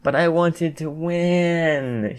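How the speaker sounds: high, very drawn-out whine; whiny moan. A narrator's reading. Mina throws a fake tantrum after losing, moaning. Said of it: "she moaned".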